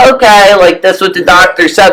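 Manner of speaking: high, sheepish little yes-voice; mock naive obedience. Mimicking trusting old folks who obey their doctor, high and meek. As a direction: play the meek patient who just goes along. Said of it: "and then they're like".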